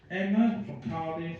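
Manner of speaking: ordinary talk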